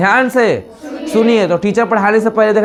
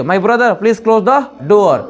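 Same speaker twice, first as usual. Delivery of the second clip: slow, carefully spoken request to brother; slow polite request; much slower than usual. Retold or imitated. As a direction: ask brother slowly and politely. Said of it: "शांति बोल सकती है".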